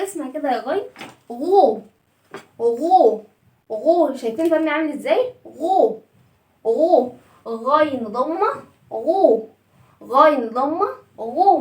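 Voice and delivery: غو with lips pushed round, u vowel; rounded gu sound. Shown as a demonstration. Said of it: "هنضم الصوت". (غو بضم الشفايف)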